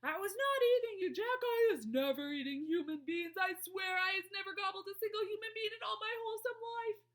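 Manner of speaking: high, loud, frantic denial; screech. A narrator's reading. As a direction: screech desperately as if pleading for life. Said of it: "he screeched".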